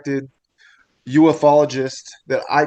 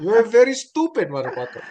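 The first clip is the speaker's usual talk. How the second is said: a lighter hint of an Indian accent; Indian shopkeeper accent; 6 semitones higher than usual. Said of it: "That's one accent I can't get right is the, the Indians".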